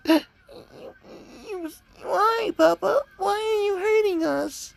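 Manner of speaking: put-on very high, tearful little-girl voice, gasping and crying; a small child sobbing to her papa; fake Eri as a crying little girl. Fake Eri cries to Papa as he hurts them, in a high sobbing child voice. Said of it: "fake Eri's just like".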